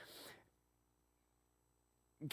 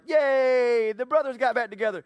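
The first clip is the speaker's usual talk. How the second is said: higher, louder sugary movie cheer; mock cheer; 8 semitones higher, 7 dB louder than usual. Viewer cheering a happy movie ending, higher and louder. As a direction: gush like a Hallmark ending. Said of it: "and you're like".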